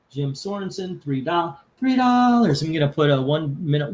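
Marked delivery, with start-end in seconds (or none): 1.8-2.6 higher and louder, announcing the bid; auction call. Shown as a demonstration. Announcing Jim's bid to the viewers, called out loud.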